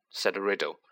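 plain reading voice

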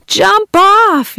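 high urgent yell. Acted. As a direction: shout a warning. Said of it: "yelled Joan and Bob".

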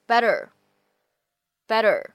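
"better" with the double T as a quick tap; American flap T. Shown as a demonstration. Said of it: "独有的闪音的处理".

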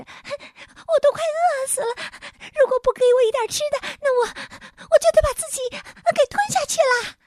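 very high, loud starving imp; thin shrieking little creature. A narrator's reading. Starving little man threatens to swallow himself, thin loud shriek. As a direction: shriek it in a tiny, piercing voice. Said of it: "用一种细细的声音尖叫".

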